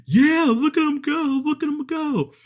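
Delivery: mock hype man cheering the dancers; hyped cheering voice. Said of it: "while the camera goes".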